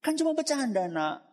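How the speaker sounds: put-on high-pitched, playful brushing-off of a child; dismissive father. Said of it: "Terus saya jawabnya gini".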